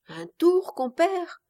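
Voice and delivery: high, rising surprised question; a startled high echo. A narrator's reading. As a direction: echo his words back in disbelief.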